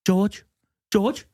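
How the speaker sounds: high, pleading calls of George; Dunkirk boat guy impression. Imitating the Dunkirk boat man calling to George. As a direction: cry out George while holding his head up. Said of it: "this is my impression of the guy on the boat in Dunkirk".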